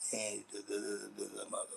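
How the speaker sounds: hushed self-talk murmur; whisper. Shown as a demonstration. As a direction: mumble it under your breath as if alone at home. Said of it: "I might whisper it or sit, talk in a low form".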